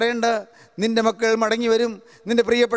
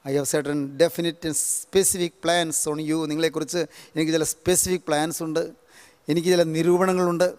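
intimate paraphrase; 6 semitones lower, 4 dB quieter than usual. Acted. Intimate paraphrase of God's promise.

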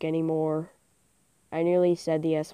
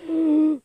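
gasp; 8 semitones higher than usual. Real, not acted. Sudden discovery in game.

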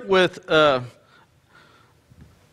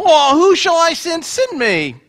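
mock innocent Moses, higher; playing dumb; 7 semitones higher, slower than usual. Preacher voicing Moses dodging God, mock innocent. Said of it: "Moses kinda plays dumb".

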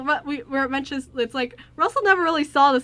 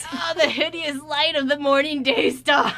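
dramatic; 4 semitones higher, slower than usual. Retold or imitated. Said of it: "We were like".

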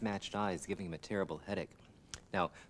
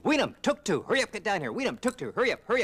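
high, loud urgent calls; frantic calling; 12 semitones higher, 8 dB louder than usual. Acted. Coyote frantically orders his eyes down, high loud calls. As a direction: yell for the eyes to hurry, panicked.

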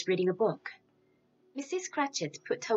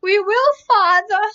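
much higher and louder cry of promise; children's eager cry; 14 semitones higher, 14 dB louder than usual. Acted. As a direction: call out to Father at full pitch. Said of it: "they all cried again".

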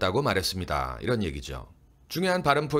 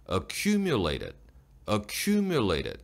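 "Accumulated" with lips pushed out on a long "cu"; slow "Accumulated", stress on "cu"; much slower than usual. Shown as a demonstration. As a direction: lean long on "kyu" in "Accumulated". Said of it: "입술을 쭉 내밀고".

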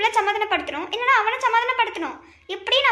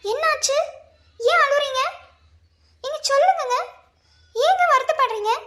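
higher-pitched anxious coaxing; worried pleading; 5 semitones higher than usual. Acted. Wife pleads with crying husband.

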